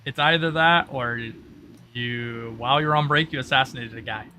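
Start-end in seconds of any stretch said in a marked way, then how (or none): none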